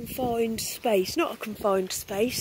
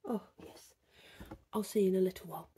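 ordinary talk, nothing marked

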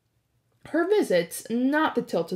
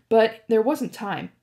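plain reading voice

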